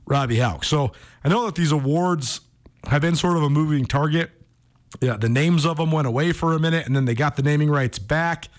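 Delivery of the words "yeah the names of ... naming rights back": ordinary talk, nothing marked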